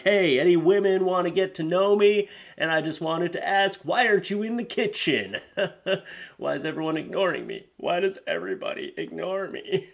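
hammed-up creep; clueless sexist guy. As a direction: hit on women like a sleazy loser. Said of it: "is like".

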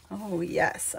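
usual speaking voice

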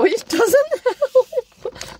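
high, stretched laughing squeal; excited giggle. Real, not acted. Telling viewers she is shaking, laughing giddily. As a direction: laugh through it, giddy. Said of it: "shaking with excitement".

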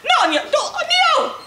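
panicked cry. Acted. Maisie panicking upside down in machine.

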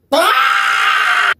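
sudden high yell of stress; frustrated scream. Real, not acted. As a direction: let out a burst of pure frustration. Stress overload outburst.

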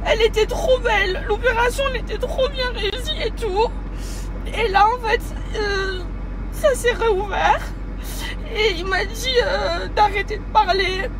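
tearful, voice high and breaking; crying. Real, not acted. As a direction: speak through real tears, pitch up. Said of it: "vous savez pourquoi je pleure".

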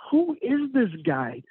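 slow, high amazed question; half-disbelieving who-is-this; retold amazement at a stranger's kindness, drawn out high; bewildered wonder. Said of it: "And I'm like".

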